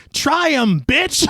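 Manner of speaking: mock Bronx shout. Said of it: "I thought he said".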